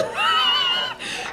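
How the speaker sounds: shrill burst of laughter; high shrieking laugh. Real, not acted. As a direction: cackle high at her. Laughing at audience member.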